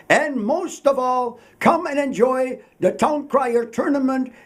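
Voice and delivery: high, ringing proclamation cry; town crier. Shown as a demonstration. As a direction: call out to the whole town square. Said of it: "This is what a town crier would normally do".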